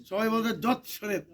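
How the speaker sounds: measured, chanted lines for repeating; mantra recitation. Acted. Priest leading the congregation's mantra, line by line, chanted. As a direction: lead the purification mantra for all to echo.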